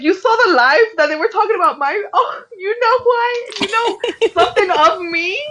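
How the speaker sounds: high, excited gush breaking into laughter; starstruck squeal. Retold or imitated. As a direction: gush like a stunned fan. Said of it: "I was like".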